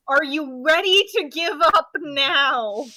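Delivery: loud, slow, forceful demand; demanding surrender. Acted. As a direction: press a pinned foe to yield. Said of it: "keep him pinned and say".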